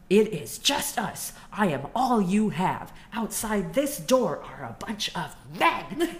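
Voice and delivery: voicing Mellie putting Olivia in her place; acting Mellie. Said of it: "she was like".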